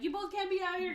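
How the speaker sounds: high, loud mock panic; retold alarm. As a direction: imitate a frantic warning, pitch way up. Panicked scolding of the two doubles.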